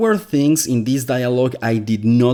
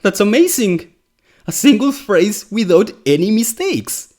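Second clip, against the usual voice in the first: high-pitched, incredulous astonishment; mock amazement; 8 semitones higher than usual. Critic finding one phrase without mistakes, mock amazement. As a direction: build from stunned disbelief to high, hammed-up amazement.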